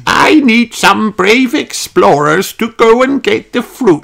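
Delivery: rally the grandchildren like a cheery granny; Granny Pig. A narrator's reading. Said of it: "says Granny Pig".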